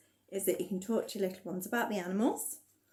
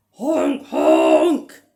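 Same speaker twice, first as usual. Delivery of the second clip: loud honking; goose noise; 5 semitones higher, 15 dB louder than usual. Pretend. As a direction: honk like a goose, loudly. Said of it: "making the animal noises".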